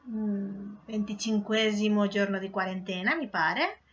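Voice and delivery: ordinary talk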